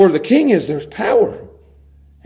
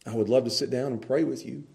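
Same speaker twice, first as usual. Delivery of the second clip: much softer, lower respectful address; respectful and gentle; 6 semitones lower, 10 dB quieter than usual. Pretend. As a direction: soothe and honour him. The wise respectful approach.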